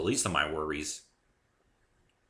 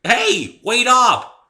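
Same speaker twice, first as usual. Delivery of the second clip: much louder and higher shout after her; calling out; 17 dB louder, much slower than usual. A narrator's reading. Dave calling after the bounding pony, loud shout. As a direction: yell to someone running off. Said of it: "I called, racing".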